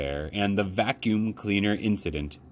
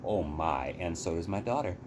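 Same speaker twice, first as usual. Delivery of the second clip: ordinary delivery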